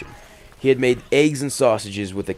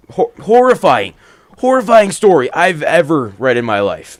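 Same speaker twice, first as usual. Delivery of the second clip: much louder and higher, fast-building rant; excited comic rant; 8 semitones higher, 10 dB louder than usual. Real, not acted. Narrator ranting to his listeners after the scary story, loud and excited. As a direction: rant to listeners about being scared.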